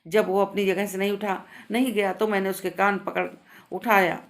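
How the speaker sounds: ordinary delivery, nothing marked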